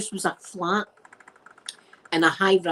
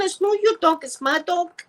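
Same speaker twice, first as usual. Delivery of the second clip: imitating mum; 9 semitones higher than usual. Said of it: "My mum's going".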